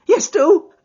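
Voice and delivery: high, loud, begging; a scared man begging Holmes to lead; pleading. Acted. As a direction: plead desperately. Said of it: "pleaded Thaddeus Sholto".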